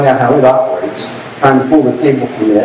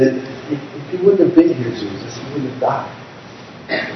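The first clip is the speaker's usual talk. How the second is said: softer and slow, grieving; sad; 8 dB quieter than usual. Shown as a demonstration. Sister grieving to Jesus over her dead brother, soft and slow. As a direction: let it fade out in grief. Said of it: "they could have said it sadly".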